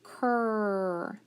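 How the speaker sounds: "cur" held long on the r; drawn-out "cur" phonics blend; isolated blend. Shown as a demonstration. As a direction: stretch out "cur" as one sound. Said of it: "So I have".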